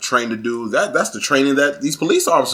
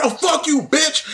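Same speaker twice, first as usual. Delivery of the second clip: high, playful fake-angry retort; mock yelling back; 12 semitones higher, slower than usual. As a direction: snap back in a fake fight, grinning. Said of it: "be yelling and cursing".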